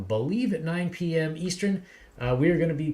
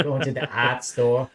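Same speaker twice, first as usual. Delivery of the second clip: put-on Boston accent; 3 semitones lower, 4 dB louder than usual. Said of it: "a very bad Boston accent".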